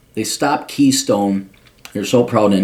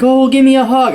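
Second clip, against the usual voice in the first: much higher, louder, sugary mock voice; mocking old hippie woman; 14 semitones higher, 5 dB louder than usual. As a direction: ham up her syrupy plea for a hug. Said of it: "she's like".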